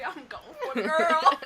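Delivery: slow, loud laughing line; loud laughing joke. Real, not acted. Joking, laughing.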